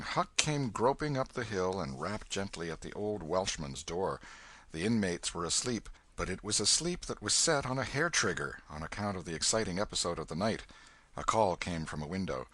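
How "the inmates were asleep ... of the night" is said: plain reading voice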